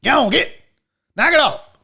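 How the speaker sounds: a lightly voiced grandpa telling a kid off; old grandpa scolding. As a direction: snap at the kid like a cranky old man. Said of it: "my old grandpa shit".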